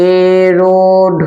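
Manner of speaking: each word alone with long pauses; slow dictation. Shown as a demonstration. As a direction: dictate one word at a time.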